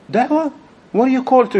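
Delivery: high-pitched, imitated disbelief; incredulous questioning. Brother challenging a preacher about his calling, incredulous. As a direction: echo it back as a pointed challenge.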